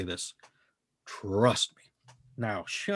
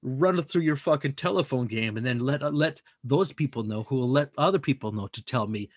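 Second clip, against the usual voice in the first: normal talking voice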